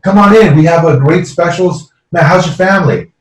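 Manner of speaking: lightly voiced restaurant owner giving a cheerful welcome; welcoming owner. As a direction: greet every guest like family. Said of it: "He'll shake your hand, say".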